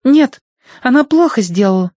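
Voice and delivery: put-on high, loud child voice; little girl voice. A narrator's reading. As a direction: speak like a demanding little girl. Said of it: "решила Агнес".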